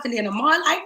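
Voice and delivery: ordinary delivery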